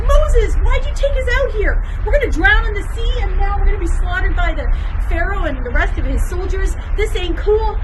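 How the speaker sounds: high, loud, fast panicky complaining; freaking out. Acted. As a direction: yell at Moses in a panic. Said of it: "were freaking out".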